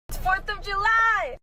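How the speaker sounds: high, drawn-out holiday cheer; excited. Real, not acted. Vlogger greeting viewers on the holiday, excited. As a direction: announce the holiday with glee.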